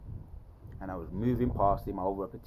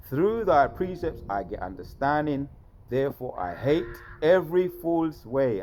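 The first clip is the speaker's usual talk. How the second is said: loud, raised-pitch declaiming of scripture; scripture recitation; 6 semitones higher, 7 dB louder than usual. Acted. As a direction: proclaim the verse with force.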